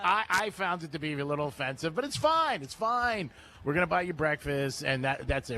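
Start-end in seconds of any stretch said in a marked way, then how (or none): 2.0-3.3 high, sing-song it's fine, pretending not to mind; a put-on huffy brush-off, pitched up; host feigning hurt about being imitated, high and huffy; mock offended.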